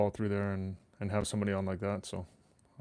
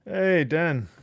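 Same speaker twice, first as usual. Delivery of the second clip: excited greeting; 7 semitones higher, 8 dB louder than usual. Real, not acted. Greeting a chat viewer by name.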